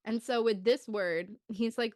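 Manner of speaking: ordinary talk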